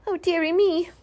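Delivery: high squeaky worried sigh; a little pig wringing his hands; high fretting squeak; fretful Piglet. A narrator's reading.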